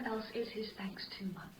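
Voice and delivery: breathy whispered aside; shy whisper to the friar. Acted. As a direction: whisper it, half to herself.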